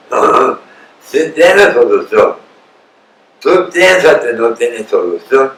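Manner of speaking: normal talking voice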